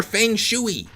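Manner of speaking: "feng shui" said high as fang-shoo-ee; alternative spelling pronunciation. Shown as a demonstration. Reader offering another reading of "feng shui", high fang-shoo-ee. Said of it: "it's spelled feng shui".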